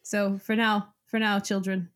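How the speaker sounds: normal talking voice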